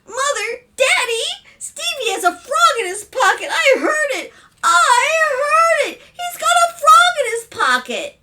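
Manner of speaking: put-on very high, loud shrieking child; screaming girl. A narrator's reading. Daughter tells parents about frog, shrieking. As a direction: scream in alarm for Mother and Daddy. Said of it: "a loud scream from Sally".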